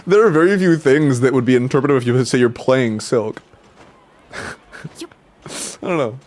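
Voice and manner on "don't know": normal talking voice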